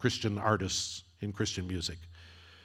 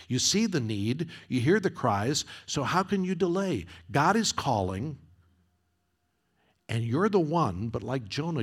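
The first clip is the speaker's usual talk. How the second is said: rising, urgent sermon cadence; preaching at full force; 3 semitones higher than usual. Acted. Preacher reads lyrics to congregation, rising and urgent. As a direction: build to a pleading climax.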